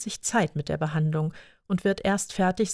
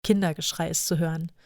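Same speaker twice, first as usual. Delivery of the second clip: ordinary delivery, nothing marked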